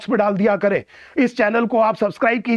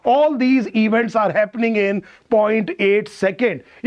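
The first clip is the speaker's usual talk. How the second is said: slow emphatic English; 2 semitones lower, much slower than usual. Shown as a demonstration. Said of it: "ये सारे के सारे event point eight second में हो रहे हैं".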